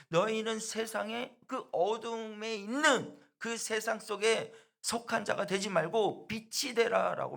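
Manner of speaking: high, loud and slow proclamation; proclaiming preacher. Acted. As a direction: call out the Lord's command. Preacher proclaims Jesus's words.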